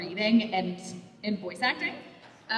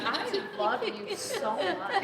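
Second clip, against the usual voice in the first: breathy laughing love; laughing, soft and slow; 6 semitones higher, 7 dB quieter, slower than usual. Real, not acted. Friend laughing fondly at the gamer.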